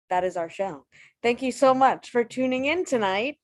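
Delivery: ordinary talk, nothing marked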